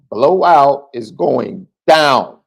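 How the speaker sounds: slow, loud, drawn-out mock proclamation; hammed-up quote. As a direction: read the viewer's line like a booming slow announcement. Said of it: "Irish Ryan Loftus says".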